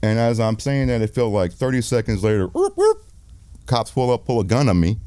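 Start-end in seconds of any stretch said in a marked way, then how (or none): 2.5-3.0 high whoop whoop like a police siren; siren imitation. Director mimics the police arriving, high siren whoops.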